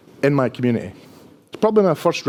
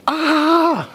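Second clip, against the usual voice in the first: sudden high yelp of panic; panicked shriek; 14 semitones higher than usual. Retold or imitated. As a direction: shriek in mock terror. Panic at the big studio.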